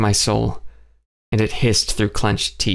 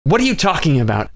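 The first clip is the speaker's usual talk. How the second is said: blurted; 6 semitones higher, faster than usual. A narrator's reading. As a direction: blurt it out in shocked protest. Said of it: "I blurted".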